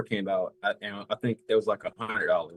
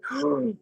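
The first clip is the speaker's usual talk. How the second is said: excited gasp. Retold or imitated. Retold excited reaction to gift.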